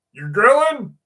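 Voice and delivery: high, loud startled "You're grilling?"; stranger's startled, high question; surprised question. Retold or imitated. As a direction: blurt it out, caught off guard.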